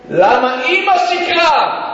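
high, slow, drawn-out question; anguished cry. Retold or imitated. Son asking why mother lied, anguished cry. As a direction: cry it out, stretching every word.

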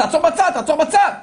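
high, loud repeated order; urgent shout. Retold or imitated. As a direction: yell it from one car to another. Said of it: "אז הוא צועק לו משהו". (צעקה דחופה וגבוהה)

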